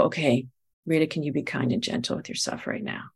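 soft, near-whispered words to herself; gentle self-talk. Retold or imitated. Talking herself into a pause, hushed and gentle. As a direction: coax yourself kindly under your breath. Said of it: "and go".

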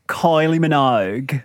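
put-on lightly overdone Australian accent; terrible Australian accent. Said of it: "in the accent. And it goes like this".

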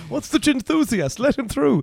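high, bright doorman waving someone through; mock doorman. Plays the doorman letting him in.

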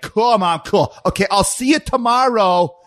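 high, mocking teenage-daughter send-off; pissed-off daughter. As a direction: imitate a huffy daughter signing off. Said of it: "Daughter gets pissed off".

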